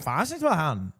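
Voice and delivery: slow, garbled mock Scottish mumble; 含糊的苏格兰口音模仿; thick Scottish imitation. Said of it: "I can't even imitate the noises".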